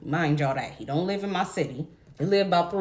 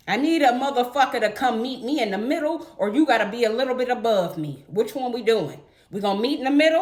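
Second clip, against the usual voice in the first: ordinary talk, nothing marked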